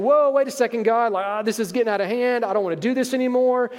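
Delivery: high, alarmed plea to stop; hammed-up panicked tapping out; mock panic. Jesus imagined begging God for a timeout, mock panic. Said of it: "He's not saying".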